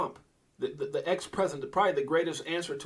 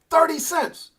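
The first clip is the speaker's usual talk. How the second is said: loud, high "Thirty cents!"; amazed exclaim; 13 semitones higher, 10 dB louder, slower than usual. Real, not acted. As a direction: blurt it out in disbelief. Amazed at the early price.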